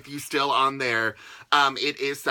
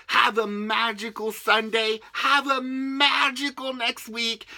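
rising higher, slow and sing-song; excited; 6 semitones higher than usual. Real, not acted. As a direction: lift it up brightly. Building sign-off greetings.

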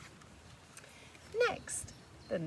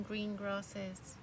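usual speaking voice

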